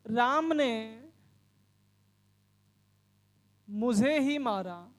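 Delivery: slow, deliberate classroom example with pauses; slow example sentence. Shown as a demonstration. Hindi teacher slowly dictating an example, deliberate. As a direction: spell the sentence out word by word for students. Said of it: "जैसे मैं बोलूं".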